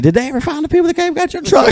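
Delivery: put-on high, fast clueless-girl voice; mock airhead, quick and high; mocking dumb girl. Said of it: "that girl was so dumb".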